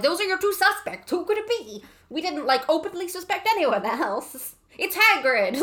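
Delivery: high, put-on mock voice; mock narrator voice. Mocking the book's setup in a high silly voice.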